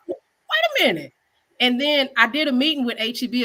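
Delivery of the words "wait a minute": high, slow drawn-out wait a minute; stunned realization. Retold or imitated. Chef retelling his discovery, high and slow. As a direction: let the idea land slowly. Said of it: "I almost hollered".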